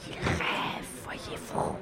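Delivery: fully whispered confidential aside; hushed, voiceless stage whisper. A narrator's reading. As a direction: whisper it so as not to hurt him. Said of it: "Cela dans un chuchotement".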